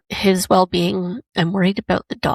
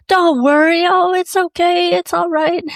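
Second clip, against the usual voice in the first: mock reassurance; 11 semitones higher, 5 dB louder than usual. Man brushing off her concern.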